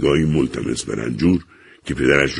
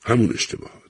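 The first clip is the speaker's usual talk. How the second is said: despondent echo; 6 semitones higher than usual. A narrator's reading. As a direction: give his father's words back, defeated. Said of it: "با قیافهٔ نومید گفت".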